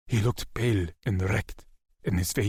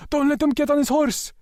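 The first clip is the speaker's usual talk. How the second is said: high, loud urgent yell; 6 dB louder than usual. A narrator's reading. Cousins yelling to restrain Lex, loud high yell. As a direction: shout to stop him. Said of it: "My cousins were yelling".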